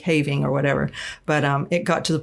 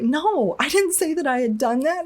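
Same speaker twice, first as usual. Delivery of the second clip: defensive protest; 10 semitones higher than usual. Retold or imitated. Retelling denying the bishop's claims, high indignant protest. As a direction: replay her disbelieving protest, pitched way up.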